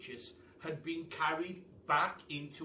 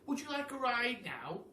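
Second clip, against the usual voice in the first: glum pelican; 9 semitones higher than usual. A narrator's reading. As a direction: sigh it out like a dejected pelican. Pelican voiced in the story.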